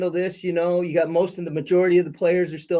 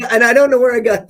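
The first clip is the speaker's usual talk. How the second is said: laughing; 5 semitones higher, 8 dB louder, faster than usual. Real, not acted. Laughing while starting funny story.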